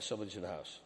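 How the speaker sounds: low and quiet, keep it down; hushed warning. Retold or imitated. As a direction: say it softly so no one inside hears. Sensing an intruder at night.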